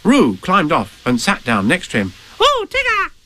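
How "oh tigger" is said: very high excited squeak; tiny voice squeaking with excitement; excited Roo. Acted. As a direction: squeal it like a thrilled little one. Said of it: "he said excitedly".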